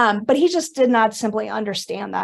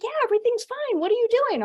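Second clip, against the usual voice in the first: high, breezy mother without a care; a carefree high-pitched mom; mom's voice; 11 semitones higher, faster than usual. Retold or imitated. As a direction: play mom, chirpy and oblivious. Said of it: "And she's like".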